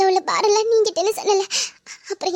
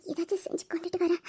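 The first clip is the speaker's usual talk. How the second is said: hushed, bashful offer of food; shy whisper; 10 dB quieter than usual. Acted. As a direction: whisper it shyly to her husband. Shy wife pleading softly. (வெட்கத்துடன் கிசுகிசுப்பு)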